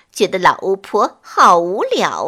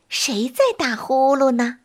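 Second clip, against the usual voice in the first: usual speaking voice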